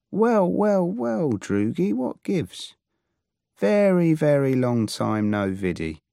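put-on high, sing-song greeting to an old friend; playful greeting. Alex greeting his old droog Pete, high and playful. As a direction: lilt it up like teasing an old pal.

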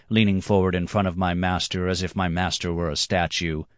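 plain reading voice

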